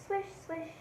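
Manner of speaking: usual speaking voice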